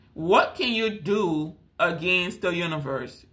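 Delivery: slow and deliberate, each word laid down; a slow, serious question about facing the universe; slow, weighty question. Shown as a demonstration. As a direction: ask it slowly, leaning on What. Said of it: "You're this big".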